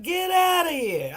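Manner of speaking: loud slow shocked get outta here; drawn-out incredulous yell; mock disbelief. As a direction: play up the shock, half laughing. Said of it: "I was like".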